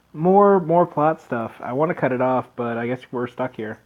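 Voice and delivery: usual speaking voice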